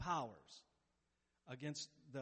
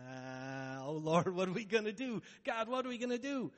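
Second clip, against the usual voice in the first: panicked cry to God; 3 semitones higher, 7 dB louder than usual. Retold or imitated. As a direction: cry out in mock panic before the enemy horde. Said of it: "And you're sitting here going".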